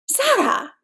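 high-pitched cry of the name; scandalised cry. Acted. Someone reacting to her wanting hockey players, scandalised. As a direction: exclaim it high, appalled.

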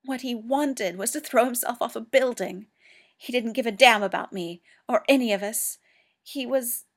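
John raging about Sherlock's fall; anguished outburst. A narrator's reading. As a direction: let the grief erupt then break. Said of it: "he broke off".

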